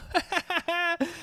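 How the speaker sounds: soft high staccato laugh; brief quiet high-pitched giggle. Real, not acted. Laughs while dropping the topic.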